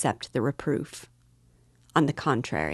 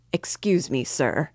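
shaking with anger. A narrator's reading. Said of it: "quivering with fury".

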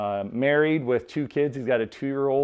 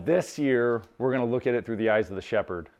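ordinary talk

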